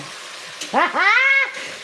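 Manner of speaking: high burst of laughter at herself; 1 big laugh. Real, not acted. Cooking vlogger laughing at herself, big laugh. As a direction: laugh out at her own mix-up.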